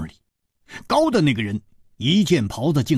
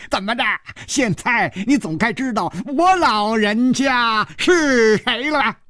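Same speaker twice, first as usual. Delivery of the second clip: put-on triumphant old dwarf; 11 semitones higher than usual. A narrator's reading. Dwarf demanding Hu Tiehua name him, triumphant. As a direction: cackle and crow it high, stretched out.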